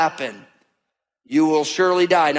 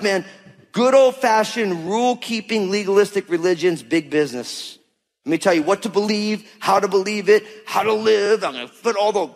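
ordinary talk, nothing marked

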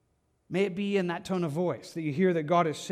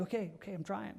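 soft, chastened protest; cowed reply; 11 dB quieter, faster than usual. Retold or imitated. Listener answering an angry command, meek and hushed. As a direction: back off meekly, much quieter. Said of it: "and you're like".

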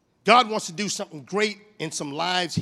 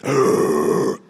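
loud, low mock growl; long, disgusted groan in imitation; attitude grunt; 12 semitones lower, 7 dB louder than usual. Imitating the secretary's attitude, exaggerated loud low groan. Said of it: "she just has this attitude like".

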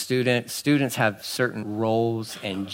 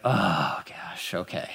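low, sighed-out words fading almost to breath; exasperated sigh; 7 semitones lower, 8 dB quieter, much slower than usual. Shown as a demonstration. Answering someone with a ridiculous question, sighing in exasperation. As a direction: let the words sink out on a weary sigh. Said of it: "This is a different kind of sigh".

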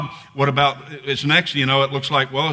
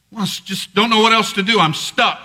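higher-pitched exasperated outburst; mock desperate complaint, pitched up; acted frustration; 7 semitones higher, 4 dB louder than usual. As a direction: act at a loss and fed up. Acting out feeling stuck, after yell.

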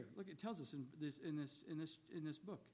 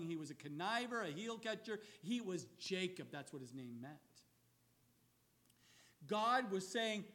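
normal talking voice

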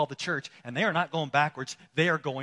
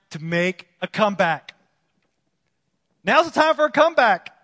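rousing preacher's appeal, swelling to a shout; rally the congregation with full-voiced urgency; forceful preaching; 5 semitones higher, 8 dB louder than usual. Preacher urging those who have been retreating to come back, rousing preaching.

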